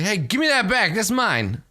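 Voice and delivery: high-pitched mock protest; indignant demand. Game master as drunk man robbed of his flower, indignant. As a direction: demand it back, pitched well up.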